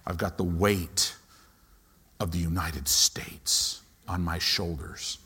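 low, hushed, heavy, fading into a whisper; mock burdened intercessor. Pastor mocking gloomy intercessors, low and whispered. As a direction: mimic a grim intercessor under the weight. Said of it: "they're serious".

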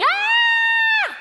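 high, loud anguished scream; retold anguished scream. Imitating the mother's high scream of grief. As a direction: let out a grieving parent's high cry. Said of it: "they're screaming".

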